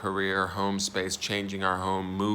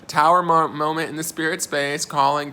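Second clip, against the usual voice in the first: excited announcement; 7 semitones higher, 7 dB louder than usual. Acted. Announcing Tower moment.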